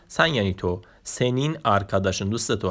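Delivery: normal talking voice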